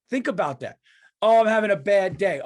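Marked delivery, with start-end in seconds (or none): 1.2-2.4 loud mock whine of self-pity; mocking complainer. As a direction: mimic a moody person's loud complaint. Mocking a moody complainer's excuse.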